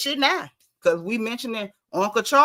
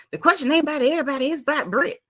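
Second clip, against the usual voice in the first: fast, higher put-on Portia voice, rattled off; mock Portia; 5 semitones higher, much faster than usual. Host mimicking Portia pressing Kenya, fast mock voice. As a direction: rush it like a pushy castmate. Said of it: "talking about".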